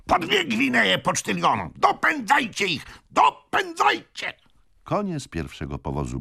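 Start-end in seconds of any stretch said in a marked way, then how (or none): 0.1-4.3 very high, urgent shouting; frantic yelling. A narrator's reading. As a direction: yell orders to chase them down. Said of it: "wrzeszczał stary gentleman".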